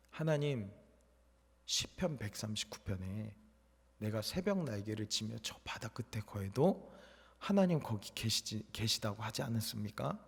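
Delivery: soft, hushed plea to God through tears; a soldier praying alone on the road, softly tearful; tearful quiet prayer. Retold or imitated. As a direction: pray quietly as if near weeping. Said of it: "걸으면서 눈물이 이렇게 나더라고요".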